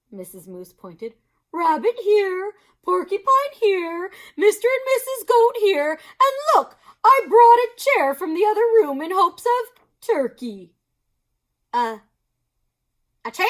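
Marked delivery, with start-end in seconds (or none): 1.5-10.7 high, louder bustling hostess; Missus Moose. Acted. Missus Moose cheerfully seats her guests. 11.7-13.5 stammering. Acted. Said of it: "Turkey stammered".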